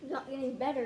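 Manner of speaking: normal talking voice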